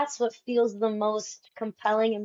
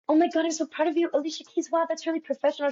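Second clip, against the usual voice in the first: mock gushing; 5 semitones higher, much faster than usual. Mocking a polite fan.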